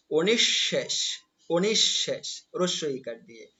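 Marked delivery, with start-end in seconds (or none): 0.1-2.3 অনিঃশেষ as "o-nish-shesh" with held double sh; correct pronunciation demo. Shown as a demonstration. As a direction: sound out অনিঃশেষ, lingering on "shsh". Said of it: "অনিঃশেষ শব্দের উচ্চারণ হবে".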